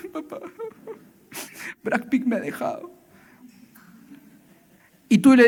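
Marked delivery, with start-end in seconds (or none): 0.1-2.9 soft, breathy mock sob of a jilted girl; lightly voiced weeping teen; mock crying daughter. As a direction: play the heartbroken daughter, half-joking. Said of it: "tu hija te viene llorando".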